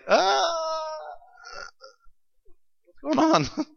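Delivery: high, soft held scream trailing into a question; thin endless scream, then a high puzzled question; mock scream. Preacher imitates someone thrown in the fire, softly mock screaming. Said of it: "You know that scream".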